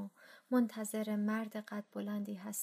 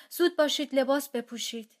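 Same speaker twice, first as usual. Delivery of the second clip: loud, urgent shout, higher up; father shouting; 4 semitones higher, 8 dB louder than usual. Acted. Father shouting at family during air raid, loud urgent yell. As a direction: yell the order to dress fast. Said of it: "بابا داد زد".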